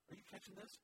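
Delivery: ordinary talk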